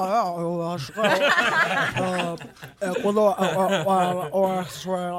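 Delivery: low, hesitant, laughing dad; father voice. Pretend. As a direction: stumble like a flustered father. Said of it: "Aí eu faço o pai dela".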